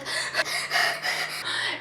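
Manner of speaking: rapid audible panicked breaths, acted out; mock hyperventilation; hyperventilating. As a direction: pant fast as if panicking. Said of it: "I am hyperventilating, like".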